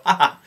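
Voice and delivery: a loud mock "ha ha" passed off as a cough; loud laugh disguised as a cough; fake cough laugh. Shown as a demonstration. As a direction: bark out a laugh pretending it's a cough. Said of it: "when I cough, I go".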